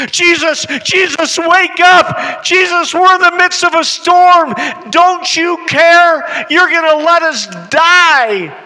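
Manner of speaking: high, loud pleading yell; desperate shout. Acted. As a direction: scream at him to wake up. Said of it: "And he begins to panic".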